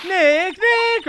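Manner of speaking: high, urgent call of a name; worried calling. Acted. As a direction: call out to a friend in alarm. Plays Maya calling out, worried.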